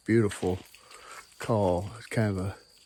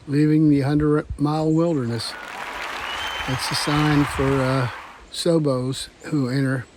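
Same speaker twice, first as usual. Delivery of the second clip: excited; 4 semitones higher, 6 dB louder than usual. Real, not acted. Excited finish of wilderness section.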